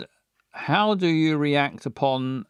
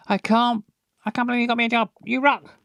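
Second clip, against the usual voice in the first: excited Emily; 6 semitones higher, much faster than usual. Acted. Emily thrilled about the job.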